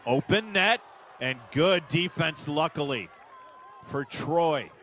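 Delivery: high, loud burst of live commentary as the net opens; excited shout. Real, not acted. Near goal, live commentator erupts.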